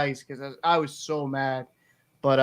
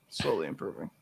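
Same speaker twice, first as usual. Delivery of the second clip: much lower and softer, fading echo to himself; low trailing mumble; 11 semitones lower, 10 dB quieter than usual. Real, not acted. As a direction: drop it under your breath like an afterthought. Repeats the phrase trailing off.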